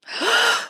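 high, sharp gasp of awe. Retold or imitated. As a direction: catch the breath at the sight. First sight of the dress.

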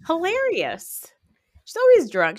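normal talking voice

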